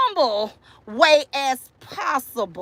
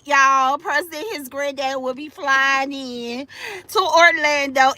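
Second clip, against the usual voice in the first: ordinary talk, nothing marked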